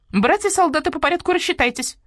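high, loud drill-sergeant call; starling replaying soldiers on the radio; parroted command. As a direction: bark the roll call like an officer. Starling repeats a military radio.

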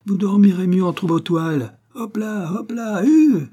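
high calls building to a loud cry; shouted driver's commands; coachman yelling. A narrator's reading. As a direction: urge him on like a horse. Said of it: "hurlait le cocher".

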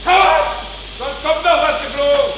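high enraged shout; furious yelling. Acted. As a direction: threaten in rage. Furious threats. (wütend brüllend)

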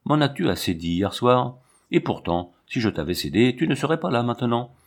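plain reading voice

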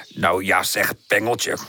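put-on low, curious old-scholar voice; mildly puzzled scientist addressing an insect; absent-minded wise professor. A narrator's reading. (lage, verwonderde professorstem)

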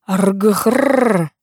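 rough growling snarl; dog growl. A narrator's reading. The pet growls at the guest, snarling. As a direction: growl like an angry little dog.

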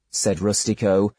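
ordinary delivery

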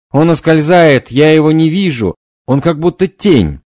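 high, loud frantic calling; frantic cry. Acted. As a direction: panic as the vision slips. Medium loses the vision.

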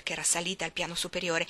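usual speaking voice

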